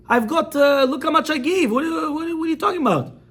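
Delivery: high-pitched, incredulous protest; indignant protest. Retold or imitated. Defendant protests in retold trial.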